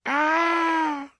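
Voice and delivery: high, loud "Ah!" scream; startled scream. A narrator's reading. Sleeper wakes from a monster dream, startled scream. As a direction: scream as if waking from a nightmare. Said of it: "Mit einem Schrei sprang er auf".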